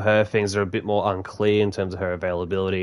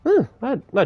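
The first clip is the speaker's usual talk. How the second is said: surprised; 13 semitones higher than usual. Real, not acted. Realizes he survived the game.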